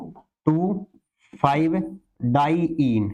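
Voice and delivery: "टू", "five", "diyne" each said separately with long pauses; word by word. Shown as a demonstration. Teacher repeating the compound's name for students, word by word. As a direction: dictate "टू ... five ... diyne" one piece at a time.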